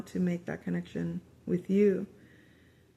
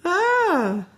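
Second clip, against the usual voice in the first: high, loud sudden ah of realization; a sharp rising gasp of discovery; surprised ah; 11 semitones higher, 7 dB louder than usual. Real, not acted. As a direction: it clicks all at once. Realizes what the card says.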